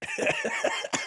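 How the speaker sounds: high screeching eagle cry attempt; eagle sound. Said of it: "I can't make the eagle sound".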